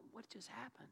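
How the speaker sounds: barely-there murmur of amazement; quiet wonder. Acted. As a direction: wonder it softly to yourself. Acts out man's wondering reflection.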